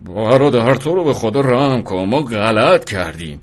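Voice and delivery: stammering, drawn-out frightened plea; terrified pleading. A narrator's reading. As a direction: beg for mercy in fear. (التماسِ ترسیده و کشیده)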